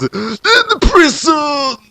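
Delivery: slow, high shouted announcer; dramatic announcement. Acted. Narrator announcing the prison scene, slow high shout. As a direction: bellow the scene title slowly.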